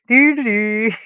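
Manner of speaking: light Muppet-chef nonsense hum; playful mock Swedish Chef gibberish; Swedish Chef imitation. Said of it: "the Swedish Chef".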